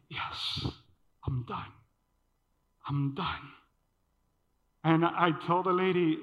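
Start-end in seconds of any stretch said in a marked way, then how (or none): 0.1-3.5 whispered, lower, softer relief; relieved whisper. Retold or imitated. Donor whispering to himself that he is done, relieved. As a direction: breathe out the relief under your breath. Relieved when the machine finishes.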